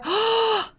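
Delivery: a sudden high gasp of shock; sharp gasp. Retold or imitated. Mother spotting her parked car, sharp gasp.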